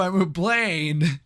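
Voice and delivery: slow, drawn-out goofy character; army plane guy voice. Retold or imitated. Reviewer mimics plane robot introducing itself, goofy character voice. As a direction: stretch it out as a silly plane-robot. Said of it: "that army plane guy comes in and goes".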